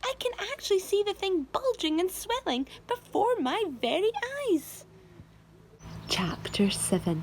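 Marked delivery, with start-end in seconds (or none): 0.0-4.8 Aunt Spiker yelling. A narrator's reading. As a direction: shriek it up high in alarm. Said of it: "Spiker yelled".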